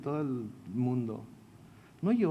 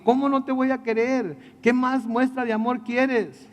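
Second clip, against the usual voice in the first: higher, louder, hammed-up protest of love; pleading husband; 6 semitones higher, 3 dB louder than usual. Samson answers his crying wife, a mock desperate plea. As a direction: beg her to believe you, over the top.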